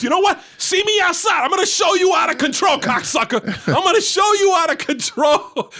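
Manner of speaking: high mock shout of a father; hammed-up threat to security; fighting challenge.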